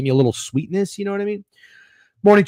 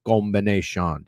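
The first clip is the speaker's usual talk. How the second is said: low mock Jamaican accent; 7 semitones lower, 5 dB quieter than usual. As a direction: quote it in a put-on Jamaican accent, pitched down. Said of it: "[name] talks like he's, uh, uh, Jamaican".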